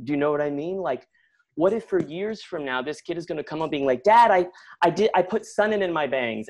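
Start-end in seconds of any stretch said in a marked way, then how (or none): 4.0-6.5 put-on high, loud, stumbling kid's confession; excited kid. Boy confessing to his dad, excited. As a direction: blurt it out like an eager boy.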